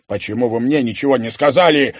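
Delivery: fast, loud roar. A narrator's reading. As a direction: bellow the question at him. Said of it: "проревел Альф".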